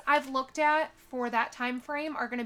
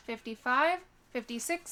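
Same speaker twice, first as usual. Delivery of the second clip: usual speaking voice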